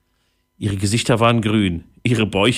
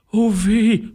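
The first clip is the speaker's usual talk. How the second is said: high-pitched groan of dismay; groaning pirate; 12 semitones higher than usual. A narrator's reading. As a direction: moan it well above your usual pitch. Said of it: "stöhnte Holzbein". (hoch stöhnender Pirat)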